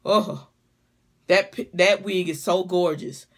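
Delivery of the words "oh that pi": usual speaking voice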